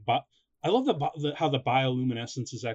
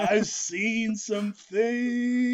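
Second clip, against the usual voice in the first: very slow, high haunted drawl; dazed stoner; 9 semitones higher, 3 dB louder, much slower than usual. Pretend. Scientist tripping on glowing plants, very slow high dazed voice. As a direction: stretch it out, spooked and spaced.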